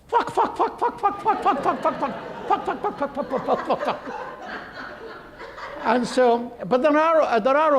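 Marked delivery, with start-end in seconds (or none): 0.1-4.3 high clucking fuck fuck like a chicken; clucking chicken curses. Retold or imitated. Imitating chickens on TV clucking high-pitched curses. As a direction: cluck the curse words like a hen.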